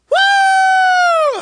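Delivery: high, long "woo" scream; piercing scream. Retold or imitated. Churchgoer screaming from the back, piercing scream. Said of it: "I hear this scream".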